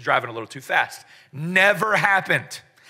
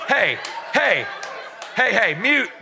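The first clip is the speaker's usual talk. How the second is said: joking "mute her" shout to wife on stage; laughing shout; 5 semitones higher than usual. Real, not acted. Wife grabs his mic.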